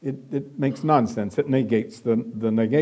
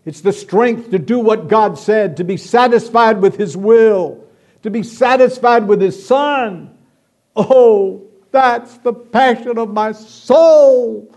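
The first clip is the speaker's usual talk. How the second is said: rising, louder preacher's build, slowing at the end; fervent preaching; 7 semitones higher, 7 dB louder than usual. Acted. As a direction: preach it out with growing fire, drawing out the last line.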